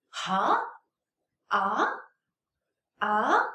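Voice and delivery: questioning "Huh? Ah?" with a sharp rising tone; second tone. Shown as a demonstration. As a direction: say "Ah?" like a big question mark, pitch sweeping up. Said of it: "Second tone is just a very big question mark".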